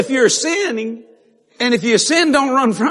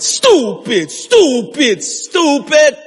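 each word drawn out and falling from high; scolding; 6 semitones higher, 3 dB louder, much slower than usual. Retold or imitated. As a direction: hammer out the reproach, rising each time. Self-scolding in the Lord's voice.

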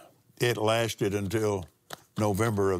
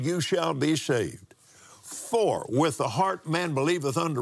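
scripture reading; 5 semitones higher than usual. Acted. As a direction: read the verses with weight. Reads scripture aloud in sermon.

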